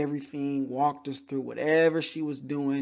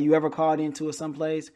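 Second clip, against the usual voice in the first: ordinary delivery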